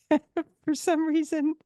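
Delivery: high, laughing embarrassed. Real, not acted. Laughing about her embarrassing ski trip moments.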